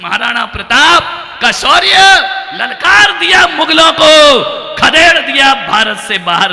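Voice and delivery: high, loud, fiery sermon cry; a monk thundering his challenge from the stage; preaching at full force. Real, not acted. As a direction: rise and roar each promise at the crowd. Heroic sermon climax.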